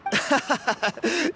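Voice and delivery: rising amused laugh; scoffing chuckle at the idea. Acted. Young man laughs off being called an outsider.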